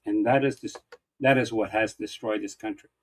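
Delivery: ordinary talk, nothing marked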